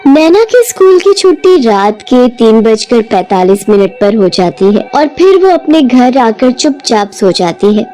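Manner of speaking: ordinary delivery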